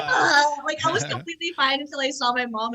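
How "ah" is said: high comic wail; imitated bawling, half laughing; hammed-up crying noise; mock sob. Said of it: "just started crying".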